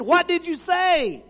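put-on young man leaning close to the preacher; pleading question. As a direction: beg him to repeat it. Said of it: "right down and said".

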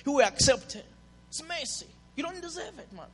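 normal talking voice

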